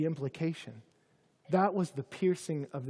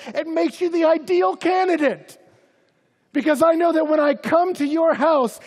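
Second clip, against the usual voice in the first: much higher and louder, near shouting; fiery preaching; 9 semitones higher, 10 dB louder than usual. Acted. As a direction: preach it with full passion. Preaching at full force.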